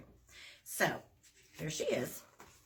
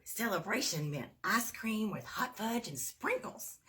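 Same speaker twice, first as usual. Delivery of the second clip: plain reading voice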